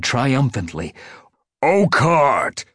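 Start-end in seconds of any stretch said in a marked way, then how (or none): 1.6-2.6 angry growled call, raised high; tabby tomcat's loud, growling challenge. A narrator's reading. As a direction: growl the name as an accusation. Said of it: "the tabby growled".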